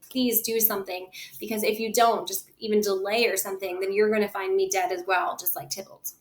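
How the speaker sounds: ordinary talk, nothing marked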